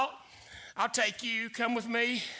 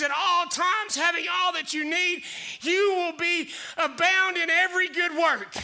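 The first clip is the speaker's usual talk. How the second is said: preaching declaration; 3 semitones higher than usual. Acted. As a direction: declare it boldly as a promise. Declaring promises over lies.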